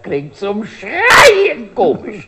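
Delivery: hoch, laut und langsam; übertrieben begeistert. Retold or imitated. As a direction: die Wirtin schwärmt lachend. Wirtin erzählt begeistert über Sächsisch. (begeistert übertrieben)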